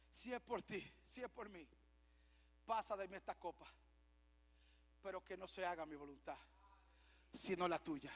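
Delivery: low, soft, halting surrender; hushed prayer. Acted. Christ praying to the Father in Gethsemane, hushed submission.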